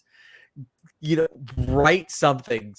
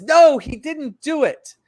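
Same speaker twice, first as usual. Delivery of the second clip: higher, louder, slower mock cry; nun's plea; 11 semitones higher, 7 dB louder, slower than usual. Nun bursting into the prison, hammed-up pleading cry. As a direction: call it out like a desperate nun.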